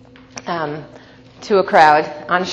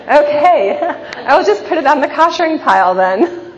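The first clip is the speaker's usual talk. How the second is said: laughing concession; 8 semitones higher, 5 dB louder than usual. Retold or imitated.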